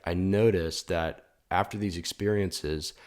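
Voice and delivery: ordinary delivery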